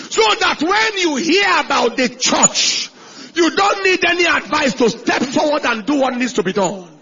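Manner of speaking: loud, ringing sermon shout; preaching at full force. Acted. Preacher shouting to his congregation. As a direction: drive the point home to the congregation.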